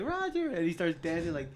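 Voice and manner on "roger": high call of a name; girl filming calls his name; girl calling him. Retold or imitated. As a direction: call out to get his attention. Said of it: "She's like".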